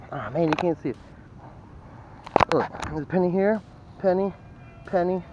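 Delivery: usual speaking voice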